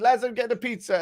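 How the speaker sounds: mock high voice of a daft pub fella. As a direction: pitch it way up, playing the fool. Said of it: "He said".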